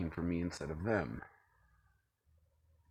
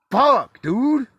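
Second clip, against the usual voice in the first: real, much higher and much louder exasperated shout; fired-up yell at an unseen listener; excited outburst; 16 dB louder than usual. Real, not acted. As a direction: blow up in disbelief. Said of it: "I'm getting excited here".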